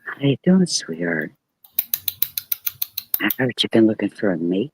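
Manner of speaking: low, soft seductive purr; sexy voice. Pretend. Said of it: "In your sexy voice".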